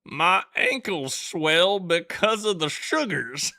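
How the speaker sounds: loud, high, slow Alabama drawl; Alabama accent. Acted. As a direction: ham up a drawn-out Southern Alabama accent. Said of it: "I read it in that accent".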